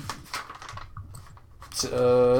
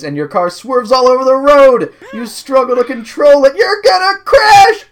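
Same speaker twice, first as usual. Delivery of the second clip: rising from loud to shouting; building panic; 9 semitones higher, 11 dB louder than usual. A narrator's reading. Narrator as the car crashes, mounting panicked shout. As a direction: build higher and louder into a yell.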